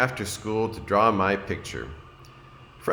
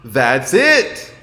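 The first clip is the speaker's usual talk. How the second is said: loud, bright-eyed discovery; a kid who's finally got it; louder, higher eureka; excited declaration; 6 semitones higher, 10 dB louder than usual. A narrator's reading. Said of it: "declared Franklin".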